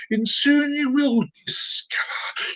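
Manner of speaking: string them along with mock mystery, slowly; theatrical teaser. Drawn-out teaser for the audience.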